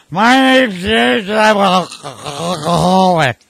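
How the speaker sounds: put-on loud, slow, sloppy drunk voice; imitating a drunk friend at the meeting, slow and loud; drunk guy sharing. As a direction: sound hammered and announce yourself proudly.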